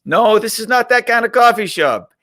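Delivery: mock Dutch-accented correction of a tourist; foreign accent. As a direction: put on a high-pitched Amsterdam accent. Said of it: "with this great, uh, accent".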